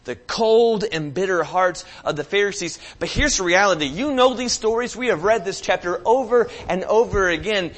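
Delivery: loud, high preaching, driving each line; fiery sermon delivery; preaching at full force. Acted. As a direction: preach it hard to the room.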